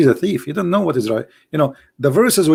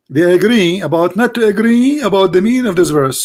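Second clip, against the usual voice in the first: louder and higher; emphatic; 6 semitones higher, 4 dB louder than usual. Real, not acted. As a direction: repeat it with force. Repeats the point, louder and higher.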